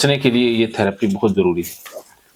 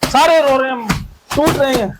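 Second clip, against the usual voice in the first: high-pitched, relentless egging-on; fired-up therapy drill leader; heated cathartic incitement; 9 semitones higher than usual. Acted. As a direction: goad them to attack the imagined enemy. Crows over imagined crying enemies.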